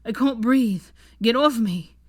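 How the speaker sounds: loud panicked yelling; desperate shout. A narrator's reading. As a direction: shout as if suffocating. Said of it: "he bellowed desperately".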